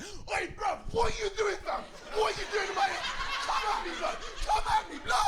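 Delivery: high, squeaky London tough-guy dog; London accent. Acted. Little dog challenging another dog, squeaky London accent. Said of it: "they've got a London accent".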